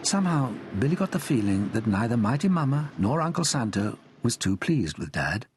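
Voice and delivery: ordinary delivery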